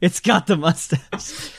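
slow words through a laugh; laughing it out slowly. Real, not acted. Laughing at the pirate fish.

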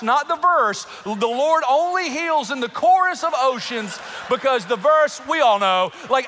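high, loud, building mock doctrine; mock fervent preaching. Preacher parodying his own healing formula, mock fervent. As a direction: proclaim the made-up formula at full volume.

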